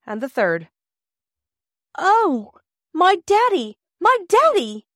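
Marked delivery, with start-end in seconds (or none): 2.0-4.9 put-on high, loud cry of a girl seeing her father; joyful scream. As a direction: scream it out with overwhelming joy. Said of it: "That scream went like a knife".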